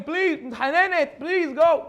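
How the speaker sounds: high, slow pleading; begging wife. Retold or imitated. Wife begging her husband to go to the show, high and slow. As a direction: plead with him to go, drawn out. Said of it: "The wife is begging him".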